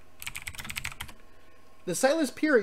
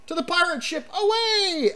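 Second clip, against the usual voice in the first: high, rousing battle call; mock heroic shout to set sail; rallying cry; 10 semitones higher than usual. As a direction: cheer the crew onward. Cheering departure.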